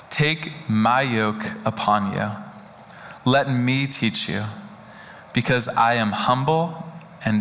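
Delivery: very slow, low, long pauses between phrases. Shown as a demonstration. Pastor rereading the passage slowly so it sinks in, hushed and deliberate. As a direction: let each phrase sink in slowly. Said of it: "I'm gonna read it a lot slower".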